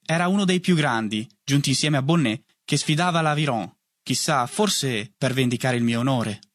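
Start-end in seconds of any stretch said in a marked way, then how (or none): none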